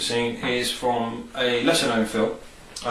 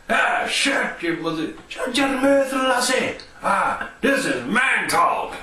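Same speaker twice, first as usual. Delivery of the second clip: Scottish Cait Sith; 12 semitones higher, slower than usual. Retold or imitated. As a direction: bark it out like a shrill little Scottish cat. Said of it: "It's just like".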